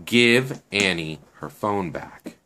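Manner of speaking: slow, measured order with spaced words; firm repeated demand. Acted. Shen insists to a stunned Natalia, slow deliberate demand. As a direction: repeat it deliberately, without hurry.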